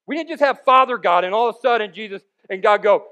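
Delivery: normal talking voice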